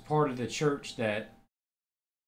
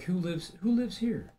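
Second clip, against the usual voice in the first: high, startled mock question, higher; mock surprised homeowner; 8 semitones higher than usual. Someone sitting down in his house, imitating a startled who lives here. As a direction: play a startled homeowner spotting an intruder, voice jumping up high. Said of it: "it's like".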